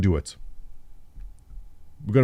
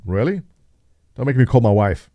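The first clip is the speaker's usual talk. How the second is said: ordinary delivery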